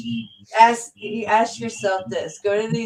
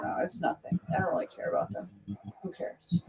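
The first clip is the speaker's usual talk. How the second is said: quiet dismissive mutter; 10 dB quieter, faster than usual. Real, not acted. Dismissive aside after ranting.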